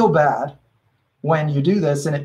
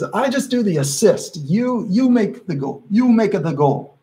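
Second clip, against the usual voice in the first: light imitation of a Brazilian Portuguese accent, pitched higher; 6 semitones higher than usual. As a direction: retell a Brazilian friend's line in a Portuguese accent, a bit higher. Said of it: "he said in his Brazilian voice, in his Portuguese accent".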